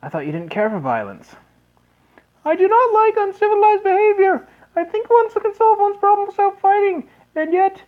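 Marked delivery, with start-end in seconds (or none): 2.4-7.8 put-on very high, louder falsetto girl; sister's voice. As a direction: squeak it as the little sister. Voicing the sister Erica.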